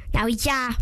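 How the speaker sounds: ordinary delivery, nothing marked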